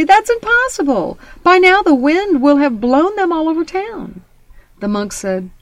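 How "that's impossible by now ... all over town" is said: high, loud, amazed protest; excited young man. A narrator's reading. Young man exclaiming to the monk, high and excited. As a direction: blurt it out in disbelief. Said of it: "the young man replied excitedly".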